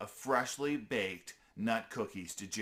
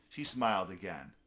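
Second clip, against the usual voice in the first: ordinary delivery, nothing marked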